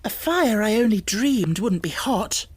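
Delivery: startled by the heat; cried. A narrator's reading. Girl starting back from the fire, crying out. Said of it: "she cried".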